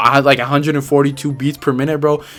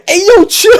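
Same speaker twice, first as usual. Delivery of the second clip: high, loud panicked call; yelled out loud; 10 dB louder than usual. Retold or imitated. Runner yelling at the chasing dog, loud and high. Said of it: "I legit out loud say".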